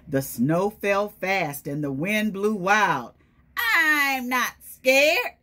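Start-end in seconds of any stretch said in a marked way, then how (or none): 3.5-5.4 put-on higher, louder, slow and defiant child voice; brave little Gruffalo's child. Reading to the kids as the Gruffalo's child, high and defiant. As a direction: puff up like a small monster pretending to be brave. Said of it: "said the Gruffalo's child".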